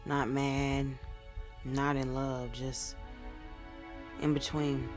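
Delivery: low, subdued spoken-word lament; subdued sadness. Acted. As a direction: speak low to a partner who has drifted away, leaving pauses. Poem about love gone distant.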